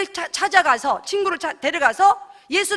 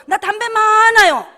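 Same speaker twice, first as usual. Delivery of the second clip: put-on proud child; 4 semitones higher, 7 dB louder, slower than usual. Child boasting to adult.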